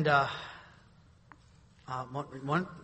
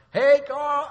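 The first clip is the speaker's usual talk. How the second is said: neighbor's greeting; 11 semitones higher, 11 dB louder than usual. Retold or imitated. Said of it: "I can hear his voice".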